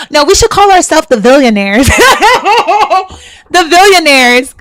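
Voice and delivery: laughing through the words, high and loud. Real, not acted. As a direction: giggle while pitching the name. Laughing while proposing name.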